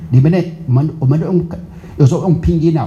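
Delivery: normal talking voice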